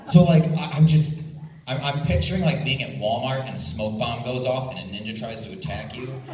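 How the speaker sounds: ordinary talk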